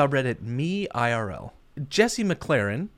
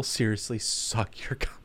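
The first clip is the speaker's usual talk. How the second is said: breathy joking whisper; 3 semitones lower, 5 dB quieter than usual. Real, not acted. Podcast host whispering a crude joke to his cohost, whisper. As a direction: drop to a whisper for the dirty joke.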